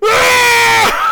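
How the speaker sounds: mock shriek, very high and loud; Bryce Dallas Howard's shriek. Imitating the actress shrieking as the truck sticks. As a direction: let out a sudden panicked yelp. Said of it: "she's going".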